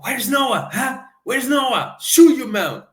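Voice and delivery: high, cocky Irish brogue mimicry; Irish accent, Conor McGregor. Fan mimics a fighter's press-conference taunt in a high Irish accent. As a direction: ham up a brash Irish fighter taunting a rival. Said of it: "el acento".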